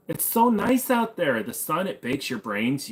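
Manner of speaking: high, slow mocking valley voice; mock flamboyant Californian. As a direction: swoon over the sunshine, camped up and slow.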